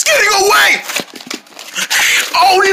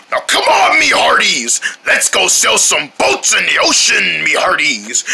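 pirate in character. Acted. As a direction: call the crew aboard like a pirate. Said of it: "you know, in character".

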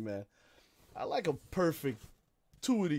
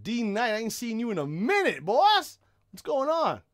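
excited greeting; 7 semitones higher, 6 dB louder than usual. Real, not acted.

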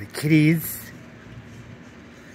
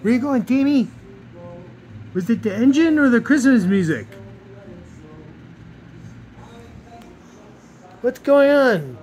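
high-pitched pet talk; 7 semitones higher, 3 dB louder than usual. Real, not acted. High voice to cat.

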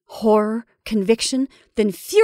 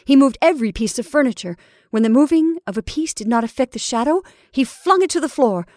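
usual speaking voice

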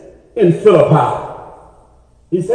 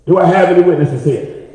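ordinary talk, nothing marked

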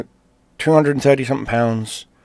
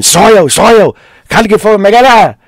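high, full-volume scream in Gaelic; 12 semitones higher, 12 dB louder than usual. Retold or imitated. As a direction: scream for your child like a frantic dad. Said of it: "Literally, he's screaming".

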